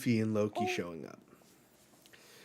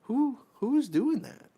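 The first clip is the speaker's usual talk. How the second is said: real surprise; 12 semitones higher, 5 dB louder than usual. Real, not acted. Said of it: "What the fuck?".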